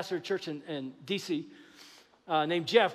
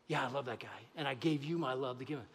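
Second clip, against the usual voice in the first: much softer and lower, tender; gentle God; 8 semitones lower, 10 dB quieter than usual. Acted. Pastor voicing God, gently answering the doubting believer, soft and low. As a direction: say it softly, like a loving secret.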